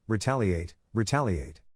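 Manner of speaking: ordinary delivery, nothing marked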